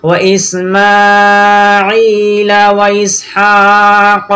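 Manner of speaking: very slow, melodic chanted tajweed; Quran recitation. Shown as a demonstration. Teacher reciting the verse for students to follow, slow chant. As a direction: chant each phrase long and even.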